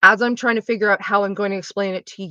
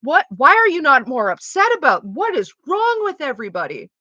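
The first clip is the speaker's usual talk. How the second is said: incredulous; 6 semitones higher, 5 dB louder than usual. Retold or imitated. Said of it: "everybody else around me is like".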